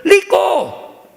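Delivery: very high, strained "lico"; frustrated father hammering "lico"; exasperated, high-pitched L for R; dad insisting. Acted. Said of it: "papá no puede pronunciar rico".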